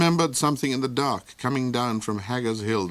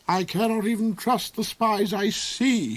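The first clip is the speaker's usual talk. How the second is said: plain reading voice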